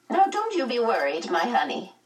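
high, creaky but pleasant; voice like an unoiled gate. A narrator's reading. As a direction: creak it out like a rusty gate, kindly. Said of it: "A voice like an unoiled gate".

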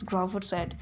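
ordinary delivery

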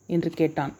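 ordinary delivery

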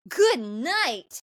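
mock-thrilled boy's reply; jazzed. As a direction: say it like he's delighted she spoke. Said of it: "he's so jazzed".

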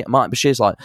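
normal talking voice

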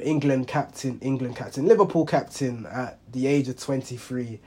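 ordinary talk, nothing marked

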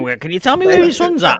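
put-on high mum voice; someone's mum. Mum phoning about her son, high imitated mother's voice. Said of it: "having your mum ringing my mobile going".

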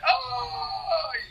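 drawn-out high "Ay", then dropping back; mock lament. Police station receives the president's daughters, mock lament. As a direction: put on an exaggerated high-pitched wail, then settle.

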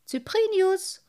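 hailing his friend; calling out. Acted. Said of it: "rief er ihn an".

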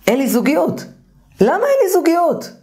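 high-pitched plaintive client, voice climbing sharply; acted client complaint. Client lamenting being single to the therapist, high exasperated complaint.

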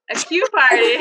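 amused echo breaking into laughter; laughing. Real, not acted. Friend echoing the tea party pun, laughing. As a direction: repeat the pun, cracking up.

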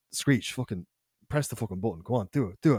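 low, hushed urging; soft, low-pitched dare; conspiratorial egging on. Retold or imitated. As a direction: goad a friend under your breath. Boys egging on a friend.

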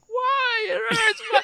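high, laughing imitation of a crying kid; hammed-up toddler crying why it hurts; mock child scream. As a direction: wail like a toddler in pain, half laughing. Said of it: "He starts screaming".

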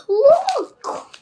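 sudden high shout of alarm. A narrator's reading. Neighbour shouting up from the balcony, high alarmed yell. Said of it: "Missus Silver was shouting".

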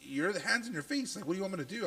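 high-pitched, pleading mock complaint; mock exasperation. Dad asking baby to move his hand, mock exasperated. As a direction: plead with a baby, half-scolding.

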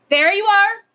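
loud, high cry of victory; triumphant cry. A narrator's reading. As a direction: crow it like you've won the argument. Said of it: "cried the Wayne Doodle triumphantly".

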